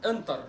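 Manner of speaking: "انتر" with a heavy Arabic ط; wrong pronunciation. Shown as a demonstration. Teacher rejecting the mistaken form, heavy T. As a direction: make the T of "انتر" thick. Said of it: "ما اسمهاش انتر".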